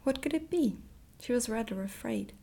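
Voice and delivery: usual speaking voice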